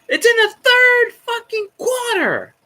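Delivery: Arthur Blank. Retold or imitated. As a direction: squeal it like a frantic team owner, drawn out. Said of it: "And then Arthur Blank's going".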